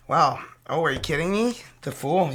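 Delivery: ordinary delivery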